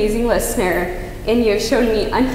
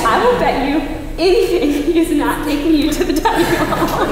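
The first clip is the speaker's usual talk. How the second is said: higher-pitched retort with a laugh; laughing retold bet; 5 semitones higher than usual. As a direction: retell the bet, breaking into a laugh.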